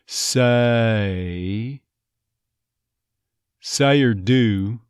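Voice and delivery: "Say" said "suh-ee" with a schwa start; slow schwa start on "Say"; Texas say. Shown as a demonstration. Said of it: "So it becomes almost a schwa".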